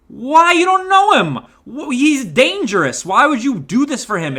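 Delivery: high, loud, incredulous protest; exasperated disbelief. Real, not acted. Reviewer challenging a character's motivation, exasperated and incredulous. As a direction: burst out high, then ease down while still protesting.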